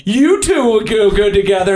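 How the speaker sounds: high, pushy friend voice; a hint of a meddling friend; playing the friend who sets you up; annoying matchmaking friend. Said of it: "that annoying friend that's like".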